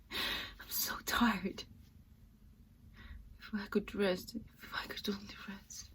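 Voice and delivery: very soft, weary half-whisper; exhausted near-whisper. Acted. Exhausted Nina wishing she could rest, weary near-whisper. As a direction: breathe it out, drained and weary.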